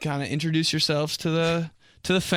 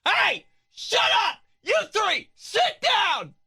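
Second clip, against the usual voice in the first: mom yelling; slower than usual. Retold or imitated. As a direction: yell at the kids to sit down. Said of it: "Completely different voice".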